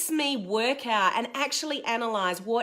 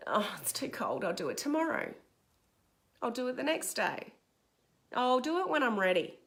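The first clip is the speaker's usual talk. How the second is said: half-hearted excuses; 7 dB quieter than usual. Acted. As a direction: make feeble excuses to yourself, quietly. Voicing excuses to skip exercise.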